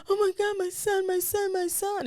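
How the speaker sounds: high-pitched mock wail with a gasp; imitating herself in a panic; retold panicked wail. Retold frantic reaction to son's illness.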